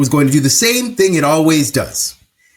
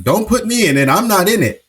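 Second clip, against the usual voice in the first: higher-pitched mock protest; protesting denial; 5 semitones higher than usual. People refusing to be counted in the war, mock protesting. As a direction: imitate people disowning any part in it.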